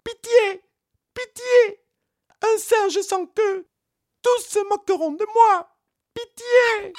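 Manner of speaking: very high, loud desperate monkey pleading; shrill monkey begging for mercy; monkey crying for mercy. Acted. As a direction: beg the court frantically. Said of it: "le singe commença à crier".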